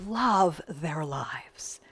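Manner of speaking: very slow, unvoiced whisper. Minister savoring the point for listeners, slow whisper. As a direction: savor each word in a whisper.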